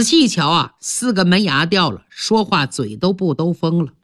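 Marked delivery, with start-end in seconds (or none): none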